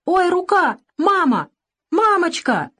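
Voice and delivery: put-on very high child's wail; crying out in pain. A narrator's reading. Hurt boy jerks away from doctor, crying out shrilly. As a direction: yelp and call for mama. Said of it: "дёрнулся и закричал".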